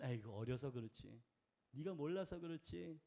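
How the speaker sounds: soft, low, forgiving murmur; gentle low-voiced excuse-making; indulgent overlooking. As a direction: let it pass as with a child. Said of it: "넘어가 주신다".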